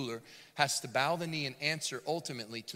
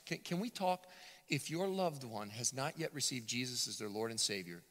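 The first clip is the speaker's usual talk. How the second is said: ordinary talk, nothing marked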